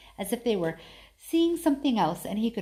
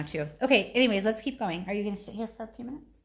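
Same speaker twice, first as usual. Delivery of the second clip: ordinary delivery, nothing marked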